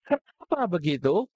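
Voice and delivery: usual speaking voice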